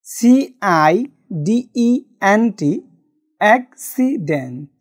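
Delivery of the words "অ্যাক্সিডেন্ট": অ্যাক্সিডেন্ট said slowly, syllable by syllable, after spelling it out; teacher slowly sounding out অ্যাক্সিডেন্ট; slow spelled-out demo. Shown as a demonstration. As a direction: model অ্যাক্সিডেন্ট slowly for learners. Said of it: "accent".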